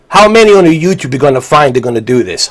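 usual speaking voice

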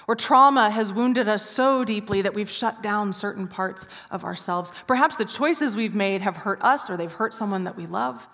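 ordinary talk

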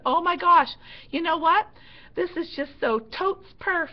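high, loud gushing slang; mock gushing teen. Instructor teasing the cadet, high mock gush. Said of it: "I said".

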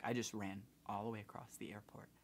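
desperate whispered plea; whispering. Retold or imitated. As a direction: whisper it, begging for help. Pleading at gate, sweating.

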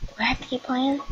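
ordinary delivery

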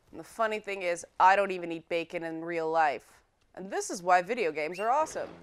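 usual speaking voice

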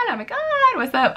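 hammed-up high-pitched fan greeting; mock fangirl squeal. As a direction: play the starstruck fan she didn't want to be. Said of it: "I wasn't gonna be like".

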